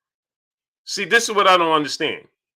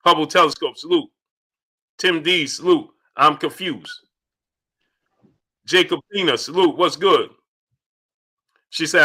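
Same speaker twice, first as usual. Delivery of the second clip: ordinary talk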